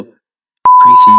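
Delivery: very high, loud name-call; re-enacted parental reprimand; retold scolding shout. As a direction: shout the kid's name, aghast. Dad scolding son again.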